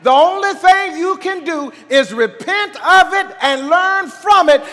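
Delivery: high, loud pulpit exhortation; fervent preacher urging the congregation on; full-force preaching. Real, not acted.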